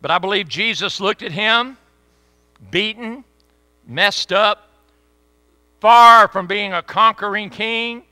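loud, high preacher building up; fervent preaching. Acted. Preaching at full force.

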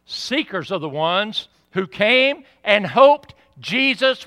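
loud, slow, high-pitched preacher's build; full-force pulpit preaching; fiery preaching. Acted. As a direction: preach it out to the congregation, rising.

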